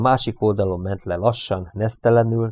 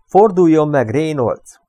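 higher, louder command; shouting; 7 semitones higher, 6 dB louder than usual. A narrator's reading. Eichmann orders Reynolds to face him, shouting. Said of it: "Újra elkiáltotta magát".

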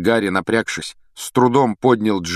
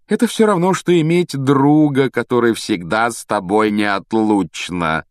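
higher, gushing mock-adoring voice; mocking imitation of a girl; 4 semitones higher, 4 dB louder than usual. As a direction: mimic her devotion in exaggerated tones. Riddle mockingly quotes Ginny's diary. (передразнивая восторженную девочку)

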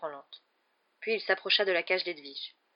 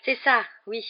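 unruffled; 5 semitones higher, 7 dB louder than usual. A narrator's reading. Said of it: "d'un air tranquille".

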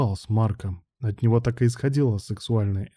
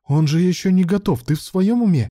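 high-pitched, louder objection; incredulous protest; 8 semitones higher, 4 dB louder than usual. A narrator's reading. Colleague objects to untrained assistant operating, incredulous. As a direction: protest as if he has gone mad. Ilya in disbelief, arguing.

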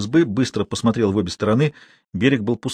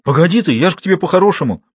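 fast, louder coaxing; hurried plea; 6 semitones higher, 4 dB louder, faster than usual. Acted. Man hurriedly pleads with angry girl. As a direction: rush to calm her down.

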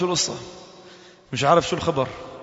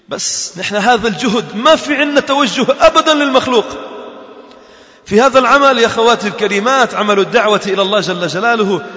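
loud, higher exhortation to the sisters; full-force preaching; 6 semitones higher, 6 dB louder than usual. Acted. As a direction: rouse the congregation with conviction.

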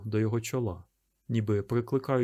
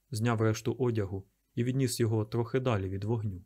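usual speaking voice

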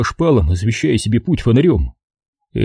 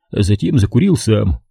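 ordinary delivery

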